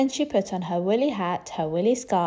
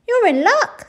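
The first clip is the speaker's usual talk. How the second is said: cook voice; 14 semitones higher, 10 dB louder than usual. Acted. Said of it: "said the cook".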